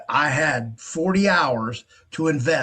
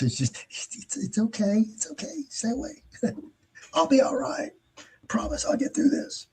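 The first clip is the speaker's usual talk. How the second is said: high, soft, whimpering little voice; mock brave victim; 10 semitones higher, 5 dB quieter than usual. Mocking scam victims in a high, breathy whimper. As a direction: play a fragile victim waving helpers away.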